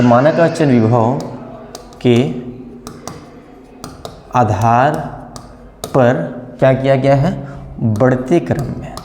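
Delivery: word by word with long pauses; slow dictation. Shown as a demonstration. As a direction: dictate so students can write. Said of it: "आप भी अपना note करते जाइए".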